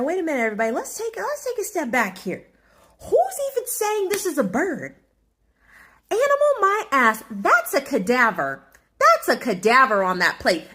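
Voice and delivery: high, indignant mock outrage; outraged rant. Telling the family at dinner the bird is a cadaver, high outraged voice. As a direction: rise up in theatrical disbelief. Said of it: "So I thought, I said".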